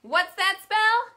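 loud, high, slow cheer call; big rising cheer question. Shown as a demonstration. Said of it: "So let's try that".

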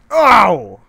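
high, loud yelp; sharp yelp of pain. Real, not acted. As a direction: cry out "Ow!" suddenly, way up high. Dolphin escapes, he gets hurt.

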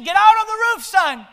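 father shouting up to his son in a burning house; dad yelling. Retold or imitated. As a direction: yell across to the son on the roof. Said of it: "he said".

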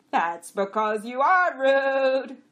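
high, loud, slow retort; accusing donkey. A narrator's reading. Jack accusing the other jack, loud and slow. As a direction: point it out loud and drawn out. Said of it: "Costel pointed out".

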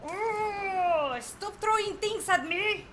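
high, whining outburst; high-pitched frustrated protest; exasperated. Real, not acted. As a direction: cry out in exasperation at the attacker. Exasperated at boss attacks.

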